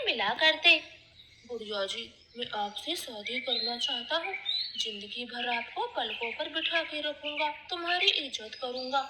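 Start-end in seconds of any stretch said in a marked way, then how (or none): 1.5-9.0 softer, lower loving promise; tender proposal. Acted. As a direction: propose gently to his beloved. Sonu proposes marriage softly.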